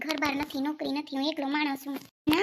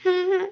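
two loud sobbing gulps; loud sobs; 6 dB louder, slower than usual. Acted. Wife sobbing loudly at husband's harsh words. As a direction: sob hard twice. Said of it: "હું તો ખાલી રડું છું".

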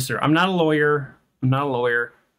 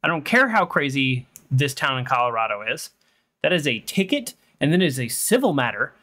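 usual speaking voice

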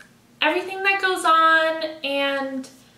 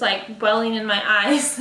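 ordinary talk, nothing marked

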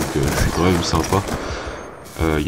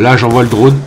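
excited; 4 semitones higher, 7 dB louder than usual. Real, not acted. Tense gameplay moment, sends drone.

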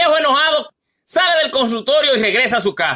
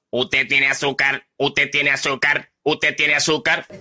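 computer voice, much lower and slow, monotone repeat; voix de robot; 10 semitones lower than usual. Acted. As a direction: speak like a machine printout, slow and low. Computadora robótica repite el resultado.

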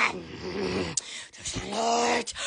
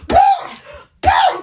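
triumphant boom; 12 semitones higher, 5 dB louder than usual. Real, not acted. Triumphant exclamation.

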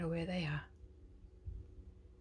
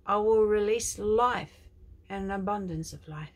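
prophetic declaration; 3 semitones higher, 9 dB louder than usual. Acted. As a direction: proclaim it like a prophet. Prophetic quote.

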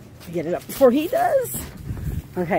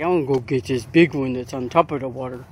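dog's voice; 4 dB quieter than usual. Pretend. Owner voicing her dog's thoughts in a low dopey voice. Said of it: "He's like".

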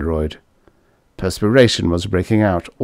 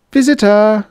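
high chirpy mechanical call; singsong door announcer; 13 semitones higher, 6 dB louder than usual. A narrator's reading. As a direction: sing it out like a robot doorbell. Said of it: "The door announcer sang out again".

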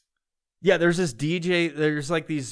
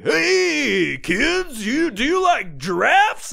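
loud, high, drawn-out pitchman; hammed-up dealership ad for kids; car salesman voice; 12 semitones higher, 8 dB louder, much slower than usual. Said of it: "this weird fucking voice that they all do".

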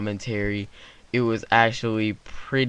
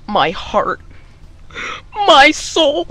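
much higher and louder, hammed-up wail; dramatic heartbreak; 9 dB louder, much slower than usual. Boy learns the girl likes his cousin, hammed-up anguished wail. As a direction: cry it out like a wounded soap star.

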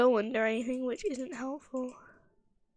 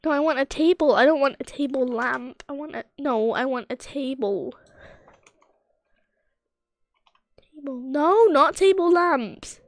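insistent; 9 dB louder than usual. Real, not acted. Arguing with the game's suggestion.

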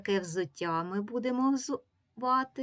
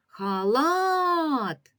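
"Халат" called out, last syllable LAT long, high and loud; loud drawn-out call stressing LAT in "Халат"; calling the word; 7 semitones higher, 5 dB louder than usual. Shown as a demonstration. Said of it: "Погукаємо".

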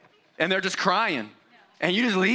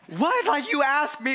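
high incredulous what; baffled what; 10 semitones higher, slower than usual. Retold or imitated. Dad baffled when daughter covers her ears, high surprised. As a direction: squeak it in total disbelief. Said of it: "And I'm like".

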